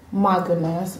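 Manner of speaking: low, soft aside; understated swoon. Real, not acted. First take, low, then exaggerated.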